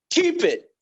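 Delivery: short high-pitched exclamation; delighted approval. Retold or imitated. Film producer approving the changed scene, high excited verdict. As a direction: jump up high with instant approval. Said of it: "he said".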